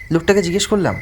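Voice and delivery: ordinary delivery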